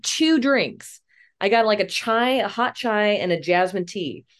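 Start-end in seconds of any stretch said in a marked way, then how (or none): none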